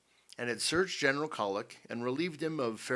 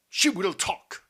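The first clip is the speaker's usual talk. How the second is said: loud, high, furious threat; losing iron control; 9 semitones higher, 10 dB louder, slower than usual. A narrator's reading. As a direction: burst out with a forceful threat. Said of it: "losing his iron control".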